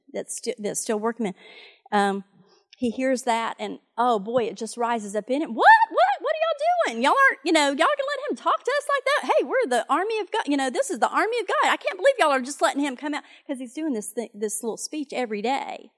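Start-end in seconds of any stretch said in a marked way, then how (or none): 5.5-13.2 high, loud indignant disbelief; shrill outrage at the army; outraged young David. Retold or imitated. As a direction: demand why nobody's fighting.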